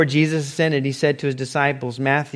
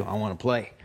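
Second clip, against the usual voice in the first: low, soft, deadpan reply; mock sheepish admission; 5 semitones lower, 7 dB quieter than usual. Worker asked what he wants to do, mock sheepish. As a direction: drop pitch and volume for a comic answer. Answering what do you wanna do.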